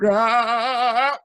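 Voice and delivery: long, high, loud scream; fleeing scream. A narrator's reading. Storyteller playing people running out, long scream. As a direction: scream like a crowd in flight.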